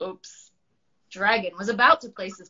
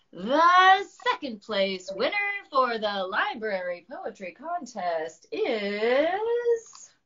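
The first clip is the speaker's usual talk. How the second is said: announcer voice; 5 semitones higher, slower than usual. Acted. Miss Llama announcing winner.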